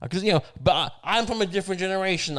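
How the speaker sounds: ordinary delivery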